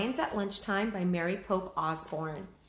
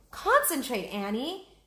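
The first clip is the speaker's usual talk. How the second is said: urgent Jack; 6 semitones higher, 4 dB louder than usual. Acted. Jack urges Annie.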